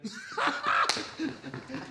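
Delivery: breathy burst of joyful laughter. Acted. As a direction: laugh out in delighted disbelief. Peter sees risen Jesus.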